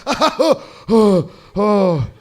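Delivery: loud mock wheezy laughing gasps; big acted gasps for air; out of breath laughing. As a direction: imitate someone laughing until out of breath. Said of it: "got out of breath from laughing".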